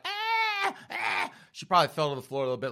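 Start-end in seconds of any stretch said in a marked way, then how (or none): 0.0-1.3 very high squealing mock screams; dramatic wail. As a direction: squeal like a victim being hit, hammed up. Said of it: "And Precious is like".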